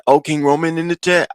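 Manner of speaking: imitated unplaceable accent, pitched high; Lemon. Imitating a man spotting someone in chat, odd accent. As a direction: put on a muddled mystery accent, high. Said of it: "we don't know what accent it was".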